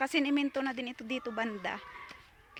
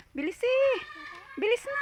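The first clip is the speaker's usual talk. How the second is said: urging; 10 semitones higher, 4 dB louder than usual. Real, not acted. Hurrying the kids along.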